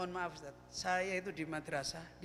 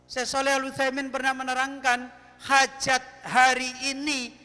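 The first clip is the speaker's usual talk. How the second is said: louder, emphatic sermon delivery; forceful preaching; 4 semitones higher, 12 dB louder than usual. Acted. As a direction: drive the point home to the listeners. Sermon climax, raised voice. (ceramah tegas dengan suara keras)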